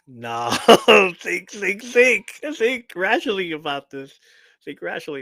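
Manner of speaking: hammed-up dad voice, half laughing; imitating a calm dad. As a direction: play the dad pleading for reason. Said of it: "the dad would be like".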